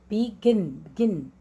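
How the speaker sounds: Begin split, weight on गिन; teacher's falling demo of गिन in begin; stress on second syllable. Shown as a demonstration. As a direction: break begin apart and land on गिन.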